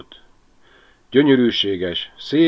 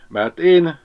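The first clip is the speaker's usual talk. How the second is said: tearful confession, pitched up and louder; 8 semitones higher, 6 dB louder than usual. A narrator's reading. Ribiszke confesses to Berti with tears in his eyes, tearful. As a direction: blurt it out through welling tears. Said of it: "mondta el könnyesedett szemmel Ribiszke".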